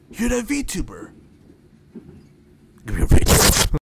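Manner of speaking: high then loud breathless grab at the face; creepy fan. Acted. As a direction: lunge at them like a creepy fan.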